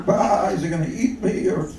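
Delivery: high, bleating mock sheep. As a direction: bleat the words like a worried sheep. Voices the sheep in joke.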